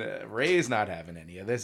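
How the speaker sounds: ordinary talk, nothing marked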